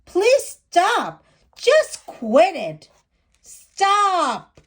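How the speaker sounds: loud, high fed-up shout; class hollering. Acted. Fed-up classmates shouting at the curious girl, loud and high. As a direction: yell it like a whole class at its limit. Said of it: "the class hollered".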